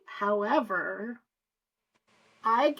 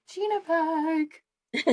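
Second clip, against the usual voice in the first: cooing at pet; 8 semitones higher, much slower than usual. Real, not acted.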